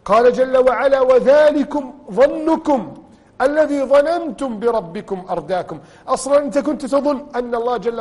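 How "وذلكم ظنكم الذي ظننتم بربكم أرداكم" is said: slow, loud, higher chanted verse; solemn recited warning; Quran recitation. Acted. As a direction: intone the verse with weight.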